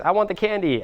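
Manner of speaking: a hint of a kid wanting a treat; demanding kid. Kid to parent in the supermarket, lightly demanding. Voices child asking for candy.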